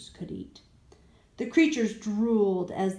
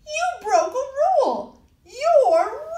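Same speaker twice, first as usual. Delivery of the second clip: loud accusation; 11 dB louder, slower than usual. Acted. Ian accusing the monsters.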